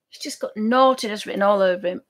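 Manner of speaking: ordinary talk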